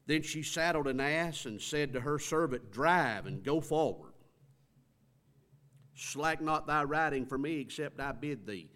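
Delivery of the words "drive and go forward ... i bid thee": firm, declaiming command; scripture reading. Acted. Shunammite ordering her servant to drive, firm reading. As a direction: read the order out strongly.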